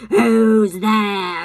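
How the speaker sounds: loud wolf growl demanding an answer; loud first growl at the stranger. A narrator's reading. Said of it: "said in a growling voice".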